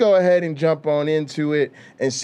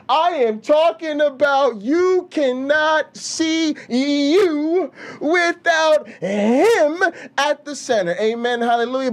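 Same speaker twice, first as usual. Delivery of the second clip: higher, slow preachy church voice; a hint of a preacher testifying; lightly hammed-up Sunday sermon; mock preacher; 9 semitones higher than usual. Mocking a preacher.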